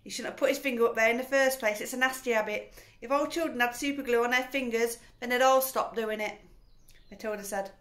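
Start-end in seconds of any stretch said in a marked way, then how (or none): 0.0-6.4 fast, loud preachy scolding; lecturing wife. A narrator's reading. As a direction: rattle off the moral loudly. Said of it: "said Missus Wormwood".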